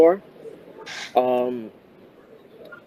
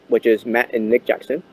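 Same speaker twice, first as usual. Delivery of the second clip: normal talking voice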